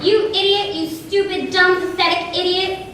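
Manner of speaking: angry yelling. Acted. Parent shouting insults at a child who is criticized, furious yell. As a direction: tear into the child with contempt.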